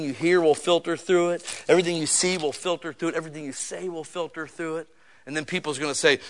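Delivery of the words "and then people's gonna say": normal talking voice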